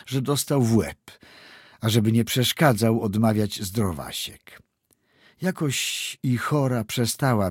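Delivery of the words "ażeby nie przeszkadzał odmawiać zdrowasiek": ordinary delivery, nothing marked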